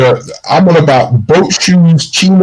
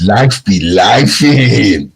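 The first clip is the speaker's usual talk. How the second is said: slow, weary drawl; 7 semitones lower, much slower than usual. Retold or imitated. Quoting the new catchphrase to a co-host, slow and weary. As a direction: drag out life be lifing low and slow, resigned. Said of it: "Now, it's".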